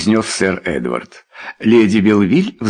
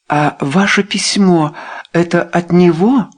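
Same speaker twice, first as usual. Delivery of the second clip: shocked questioning; 9 semitones higher than usual. A narrator's reading. Shocked wife questions husband.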